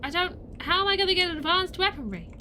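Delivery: high panicked chant of questions; frantic spiral. Real, not acted.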